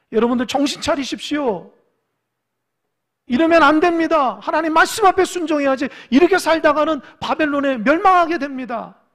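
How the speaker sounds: loud, high prophetic shouting; urgent warning cry. Acted. Prophet warning his people of ruin, urgent shouting. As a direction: shout it out to wake a crowd.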